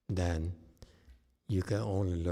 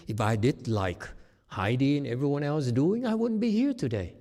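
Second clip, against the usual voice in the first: rising higher and louder into a proud boast; boastful tycoon quote; 5 semitones higher, 4 dB louder than usual. Retold or imitated. Tycoon looking back on his success, hammed-up boast. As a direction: play the self-made tycoon, bragging.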